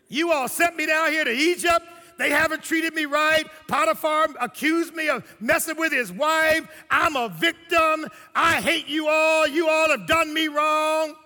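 hammed-up aggrieved rant, building to a shout; Joseph. Victim blaming his brothers, loud mock outrage. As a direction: wail the grievances loudly, slowing and stretching words. Acted bitter complaint Joseph never made.

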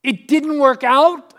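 hammed-up astonished fool; mock shock. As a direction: act stunned that it failed. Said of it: "they're gonna be shocked".

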